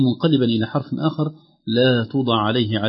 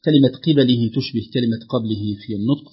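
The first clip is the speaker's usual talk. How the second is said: ordinary delivery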